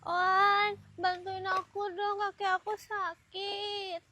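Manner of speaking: soft, slow pleading; quiet, slowed-down appeal; gentle plea. Acted. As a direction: ask for help meekly, drawn out. Second take, she pleads softly.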